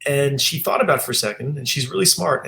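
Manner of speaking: ordinary talk, nothing marked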